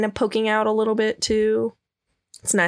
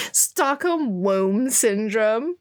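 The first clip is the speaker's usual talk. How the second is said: slow savoring repeat; much slower than usual. Real, not acted.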